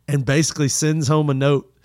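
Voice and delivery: normal talking voice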